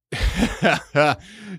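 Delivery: high, loud burst of laughter. Real, not acted. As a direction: break out laughing mid-story. Storyteller laughs mid-story.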